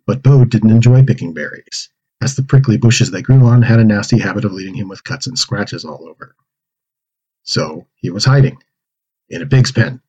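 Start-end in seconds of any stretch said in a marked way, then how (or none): none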